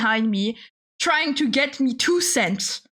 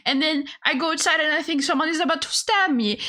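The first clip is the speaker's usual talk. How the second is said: ordinary talk, nothing marked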